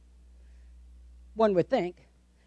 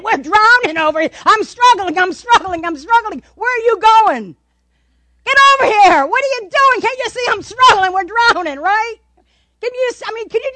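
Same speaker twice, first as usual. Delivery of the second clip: higher, louder mock-frantic outburst; panicked disciples; 6 semitones higher, 5 dB louder than usual. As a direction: yell like you're drowning and being passed by. Acts out panicking disciples in boat.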